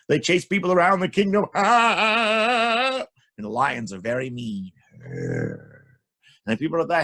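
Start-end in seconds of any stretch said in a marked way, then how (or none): 1.5-3.0 high, loud, long mock scream; chased scream. A narrator's reading. People chased by lions, loud panicky scream.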